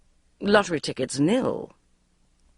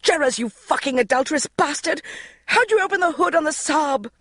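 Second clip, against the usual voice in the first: furious yell; 11 semitones higher than usual. Acted. Magda on the phone to cheating husband, furious yell. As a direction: berate him at full volume. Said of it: "she yelled into the portable phone".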